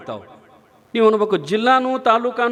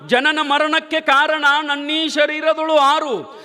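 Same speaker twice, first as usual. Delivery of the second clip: measured verse recital; 6 semitones higher than usual. Acted. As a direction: recite the saint's verse with reverence. Preacher recites Nijaguna verse.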